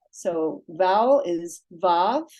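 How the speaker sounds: vav said slowly and clearly as a model; slow demo. Shown as a demonstration. Teacher modelling the first syllable for students, slow and careful. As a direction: spell out vav slowly for beginners. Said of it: "I'm gonna pronounce this".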